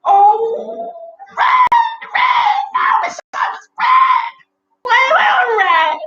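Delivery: very high, loud wailing shout; wailing cry. Acted. Percival wails loudly to the ladybugs, wishing he were red. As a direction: cry out in wild envy.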